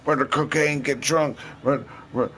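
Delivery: high, stuttering mock rap mumble; mush-mouth rapper. Mocking rappers who mumble to make money, high mush-mouth babble. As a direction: slur and stammer the words up high. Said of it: "Just mumble goddamn mush mouth all around".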